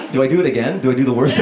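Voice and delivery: ordinary talk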